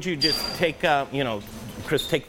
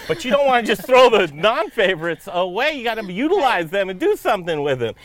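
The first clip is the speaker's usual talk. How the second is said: laughing; 7 semitones higher, 6 dB louder than usual. Real, not acted. Joking aside about favorite children.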